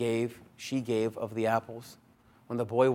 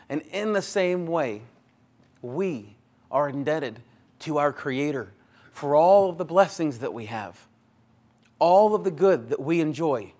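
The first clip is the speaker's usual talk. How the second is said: rising preaching; 2 semitones higher, 5 dB louder than usual. Real, not acted. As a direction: lift the congregation toward praise. Preaching builds to praise.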